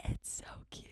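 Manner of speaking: hushed, voiceless squee; gushing whisper. Pretend. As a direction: whisper it, overcome by cuteness. Gushing over the crocheted koozie.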